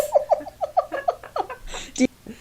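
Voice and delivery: a big high laugh, breaking up; high burst of laughter. Real, not acted. Cohost cracks up over the comment, big high laugh.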